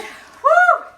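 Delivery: loud, high-pitched whoop of triumph; excited whoop. Real, not acted. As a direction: cheer like you just finished. Mom cheering after squats.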